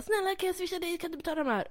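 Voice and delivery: put-on high, pleading kid voice; pleading teen boy. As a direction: beg the cashier like an eager boy. Pojken ber kassörskan. (tonåring ber ivrigt kassörskan, ljus bedjande röst)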